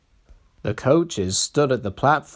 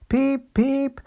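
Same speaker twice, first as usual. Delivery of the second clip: high peeping whistle; whistle peeps; 13 semitones higher than usual. A narrator's reading. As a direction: peep like a little engine. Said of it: "Peter Sam whistled".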